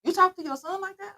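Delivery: high mock-questioning friend voice; nosy friends. Friends questioning how mom answers her son, high mimicry. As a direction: imitate a friend's prying, high-pitched question. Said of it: "They be like".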